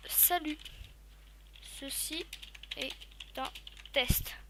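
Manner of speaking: "Ceci est un test" spoken slowly, word by word, with pauses; typing dictation. Shown as a demonstration. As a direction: dictate it word by word as you type. (dicté lentement, mot par mot)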